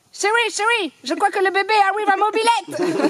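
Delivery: loud and high alarmed husband; excited husband. Acted. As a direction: cry out to the wife in mock panic. Husband panics in the joke.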